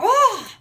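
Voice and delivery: loud, high startled "oh"; startled cry. Acted. Boy surprised by his dad, acted startled cry. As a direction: yelp as if jumped out at. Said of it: "this is how he would surprise them".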